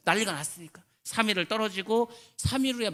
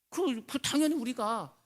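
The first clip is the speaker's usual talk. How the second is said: higher and louder exhortation; preaching at full force; 6 semitones higher, 4 dB louder than usual. Acted. Preacher urging the congregation, higher and louder. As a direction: press the point on the congregation.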